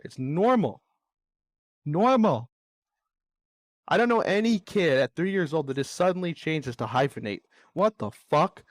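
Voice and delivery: heated re-enactment of an argument; exasperated. Retold or imitated. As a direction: argue it again, punching the key word. Re-arguing the name dispute, worked up.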